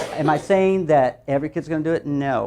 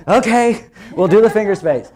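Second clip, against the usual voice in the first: laughing giving in; 7 semitones higher, 7 dB louder than usual. Retold or imitated. Giving in, retold.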